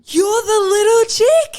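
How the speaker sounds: shrieking in delighted disbelief; an older woman's amazed squeal; astonished recognition. Retold or imitated. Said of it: "She's like".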